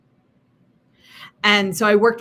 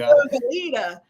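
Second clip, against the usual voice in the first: higher, louder New York accent; 6 semitones higher, 5 dB louder than usual. Shown as a demonstration. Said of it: "when I use my New York accent".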